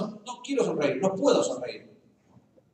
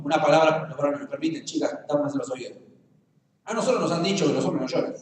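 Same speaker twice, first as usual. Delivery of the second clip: normal talking voice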